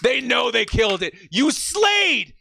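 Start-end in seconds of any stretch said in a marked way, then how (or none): 1.3-2.3 high, bright praise without shouting; excited praise. Real, not acted. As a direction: cheer him on warmly. Praising the general manager.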